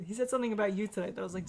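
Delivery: ordinary delivery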